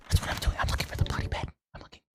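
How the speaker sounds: whispered to herself. Real, not acted. Streamer searching the map for the bed, whispered. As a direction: breathe it out with no voice.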